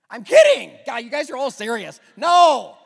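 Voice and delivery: shouting it's only a joke; loud laughing protest. Real, not acted. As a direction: burst out, teasing the crowd. Teasing the too-serious congregation.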